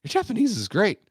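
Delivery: high, bright mock praise; imitating the attendant's praise. Toll attendant praising the driver, high mock praise. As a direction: lift it up high like an eager compliment. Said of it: "He goes".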